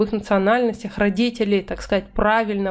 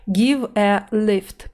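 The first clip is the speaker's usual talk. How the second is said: slow teaching read; much slower than usual. Shown as a demonstration. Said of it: "teacher reads phrase slowly".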